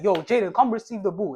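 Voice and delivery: loud, sharp shout across the pitch; shouting a quick order to a teammate; aggressive call. Pretend. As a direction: bark it like a teammate mid-game. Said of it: "said in an aggressive tone".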